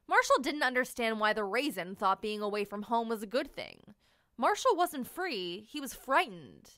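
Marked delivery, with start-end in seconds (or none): none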